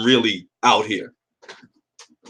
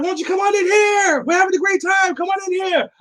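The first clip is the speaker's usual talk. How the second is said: high, shouted party invite; high-pitched shouted imitation of a woman at the club; woman calling out. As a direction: yell from the doorway like a tipsy girl. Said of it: "She's like".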